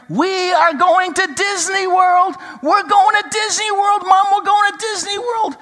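shrieking to Mom about Disney World; excited child yelling. Retold or imitated. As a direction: yell it like a thrilled little girl. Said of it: "she started yelling".

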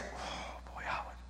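whispered sigh to himself; self-reproach. Acted. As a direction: whisper it to yourself in dismay. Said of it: "every day I go like this".

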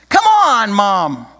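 high, loud whiny plea; pleading child. Acted. Tommy begs his mother, high and loud. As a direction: beg like a desperate little boy. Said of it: "Tommy pleaded".

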